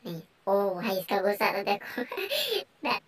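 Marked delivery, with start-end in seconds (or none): none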